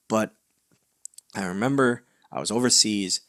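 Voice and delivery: usual speaking voice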